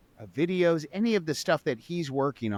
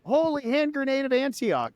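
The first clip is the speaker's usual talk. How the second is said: mock exclamation; 11 semitones higher than usual. Host reprises the full quote to hail the sponsor. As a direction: announce the holy relic grandly.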